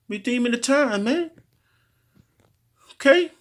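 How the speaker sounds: leaps way up on redeeming the time; preaching high. Real, not acted. Preacher rising high to drive home redeeming the time. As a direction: soar high to hammer the phrase.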